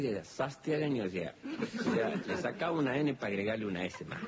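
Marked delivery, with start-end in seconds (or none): 0.2-1.0 imitando el acento santiagueño con la S de más. Retold or imitated. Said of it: "las S al final las va a pronunciar igual". (con acento santiagueño, "Sastiagueño" con S en vez de N)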